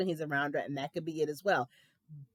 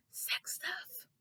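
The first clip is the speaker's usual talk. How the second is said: breathy whispered sex stuff, higher; imitated whisper; 12 semitones higher, 13 dB quieter than usual. Mocking a husband who whispers about sex. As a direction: hush sex stuff like someone might overhear. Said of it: "whispered it like you were afraid".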